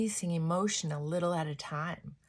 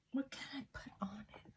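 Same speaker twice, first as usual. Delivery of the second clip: whispered to herself while working; 3 semitones higher, 13 dB quieter, faster than usual. Real, not acted. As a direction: murmur it under your breath. Talking to herself while doing makeup.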